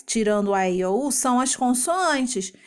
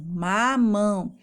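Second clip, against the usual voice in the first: MAMÃO slowly, a long MA then MÃO; syllable by syllable; much slower than usual. Shown as a demonstration. Teacher revealing fruit with that ending, slow ma-mão for MAMÃO. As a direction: separate the two syllables of MAMÃO. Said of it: "Que fruta que termina desse jeito".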